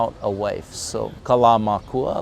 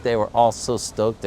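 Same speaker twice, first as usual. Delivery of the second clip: plain reading voice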